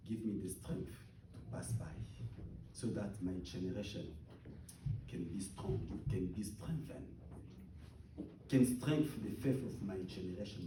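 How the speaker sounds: soft, low prayerful voice; hushed prayer. Real, not acted. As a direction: draw the congregation into quiet prayer. Closing soft prayerful passage.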